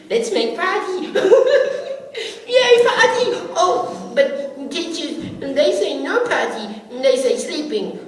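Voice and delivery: put-on high, loud, giggling childish voice; giddy little kids. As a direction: bounce with excitement like small boys. Little boys plotting a party.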